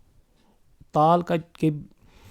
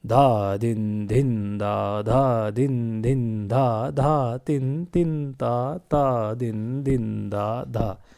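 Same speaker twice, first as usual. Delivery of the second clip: lower, chanted tabla bols at middle speed; medium-tempo madhya recitation; 3 semitones lower, much slower than usual. Shown as a demonstration. Teacher showing students middle laya in teen taal, madhya recitation. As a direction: join the syllables in a steady, moderate pulse. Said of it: "middle, मध्य लय।".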